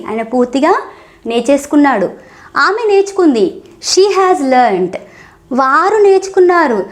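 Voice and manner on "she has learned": each word drawn out slowly for learners; deliberate teacher pacing; slow model phrase. Shown as a demonstration. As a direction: say it slowly so the class can follow. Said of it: "teacher models the sentence".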